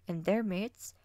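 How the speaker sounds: ordinary delivery, nothing marked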